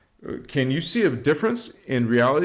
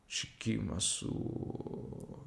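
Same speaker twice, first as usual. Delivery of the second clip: whispered sacred word; 4 semitones lower, 15 dB quieter, much slower than usual. Acted. Whispered word closing the affirmation.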